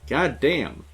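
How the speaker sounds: usual speaking voice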